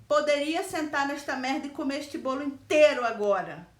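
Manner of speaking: loud, blunt confession; blunt truth. Retold or imitated. Voicing her honest craving loudly. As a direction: say it straight out.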